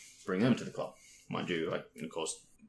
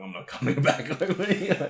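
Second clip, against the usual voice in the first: laughing; 9 semitones higher, 7 dB louder than usual. Retold or imitated. Laughing retelling of own reply.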